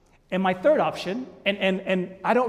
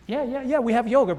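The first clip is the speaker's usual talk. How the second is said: higher-pitched, hurried yeses; impatient; 6 semitones higher, 5 dB louder than usual. Retold or imitated. Husband answering wife's yogurt question, impatient. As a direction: brush off a question that seems beside the point.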